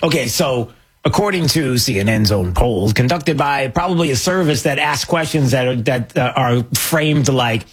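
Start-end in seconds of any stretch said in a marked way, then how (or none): none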